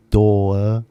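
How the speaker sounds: door said the British way, as dɔə with no r; ドアをイギリス英語で、rを落として dɔə; British accent. Shown as a demonstration. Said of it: "British people say door".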